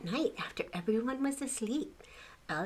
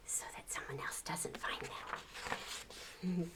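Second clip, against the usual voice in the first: whisper; 3 semitones lower, 13 dB quieter than usual. A narrator's reading. Hiding scene.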